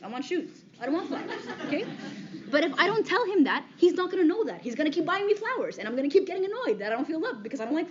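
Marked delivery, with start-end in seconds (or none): 0.0-1.8 laughing. Real, not acted. Joking about hating flowers.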